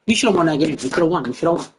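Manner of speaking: ordinary talk, nothing marked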